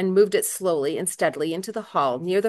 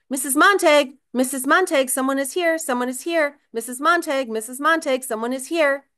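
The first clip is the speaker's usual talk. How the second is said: door announcer voice; 7 semitones higher than usual. Acted. Automated front door voice announcing a visitor, high repeated call. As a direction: call out like a mechanical doorbell.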